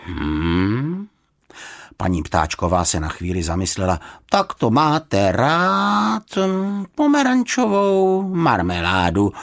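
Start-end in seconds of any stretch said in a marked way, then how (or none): 0.1-1.1 long hmm rising in pitch; drawn-out thoughtful hmm; pondering hum. A narrator's reading. Housekeeper ponders the bear's answer. 4.3-9.3 very slow, high, drawn-out housekeeper; knowing, lingering guess with a hum; musing deduction. A narrator's reading. Housekeeper deduces the bear's taste.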